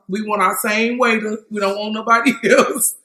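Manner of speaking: usual speaking voice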